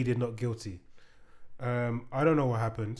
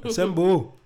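loud, raised hero's order; battle command; 5 semitones higher, 8 dB louder, slower than usual. Acted. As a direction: call the troops to arms. Performs the advert's battle command.

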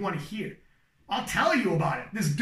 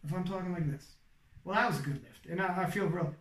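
low, softer, monotone delivery; unexcited, low monotone commentary; 5 semitones lower, 4 dB quieter than usual. Shown as a demonstration. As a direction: drone it out, no inflection. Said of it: "I'm not talking monotone right now".

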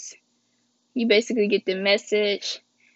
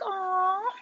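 soft touched aw; 9 semitones higher, 3 dB quieter than usual. Retold or imitated. Remembering sweet Valentine's gift.

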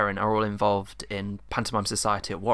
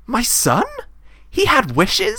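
high, loud dame disbelief; hammed-up shocked mother; indignant surprise; 9 dB louder, slower than usual. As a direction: shoot up into outraged questions.

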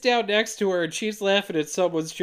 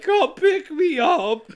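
high, slow, whiny mock tantrum; hammed-up petulant whine, stretched out; mock hissy fit; 11 semitones higher, 5 dB louder, slower than usual. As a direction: whine it like a sulking man-child. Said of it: "throwing a full-on hissy fit".

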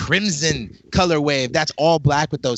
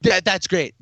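ordinary talk

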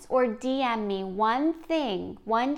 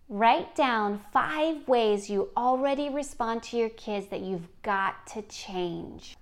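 ordinary talk

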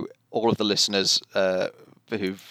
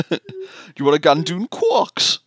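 louder, a hint of Geordie; Geordie accent; 8 semitones higher, 4 dB louder than usual. Geordie Odo jokes at the bar, light Geordie. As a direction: offer it in a playful Geordie. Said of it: "more from Geordie Odo".

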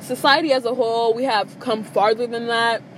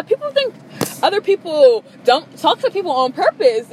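worked-up rant; 6 semitones higher, 5 dB louder than usual. Real, not acted. Venting, raised voice.

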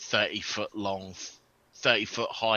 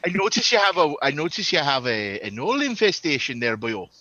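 lilting Welsh brogue, pitched up; a hammed-up Welsh dwarf accent; playful Welsh accent, raised pitch; 4 semitones higher, 3 dB louder than usual. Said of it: "I didn't do that in accent".

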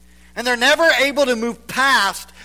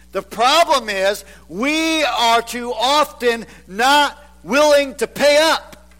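normal talking voice

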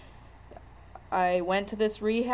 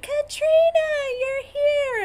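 much higher and louder, a gushing hello; excited greeting; 8 dB louder, slower than usual. Retold or imitated. Bar regulars greet their bartender Katrina, thrilled and squealing.

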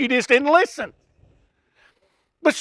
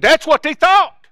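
ordinary delivery

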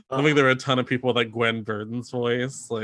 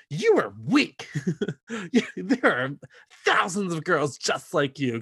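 mock Ivy insult; 5 semitones higher than usual. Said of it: "So her like".

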